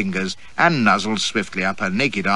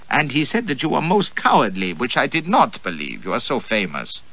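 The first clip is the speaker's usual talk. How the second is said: put-on high, eager, teasing girl's voice; 6 semitones higher than usual.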